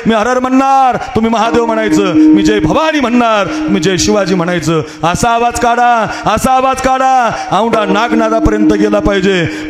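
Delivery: high, ringing sermon voice rallying the crowd; fiery kirtan preaching. Acted. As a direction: preach it up to the crowd at full force. Preacher leads chant buildup.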